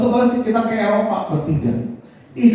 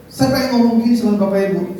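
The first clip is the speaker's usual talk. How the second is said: normal talking voice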